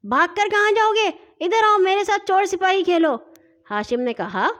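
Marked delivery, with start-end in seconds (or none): 0.0-3.1 high, quick bossy shout; fast, raised commanding call; loud shout. A narrator's reading. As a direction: yell after a boy running away. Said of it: "مٹو زور سے چلایا".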